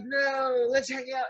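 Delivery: high laughing plea; begging like a starstruck kid; mock pleading. Pleading to meet DiCaprio, laughing.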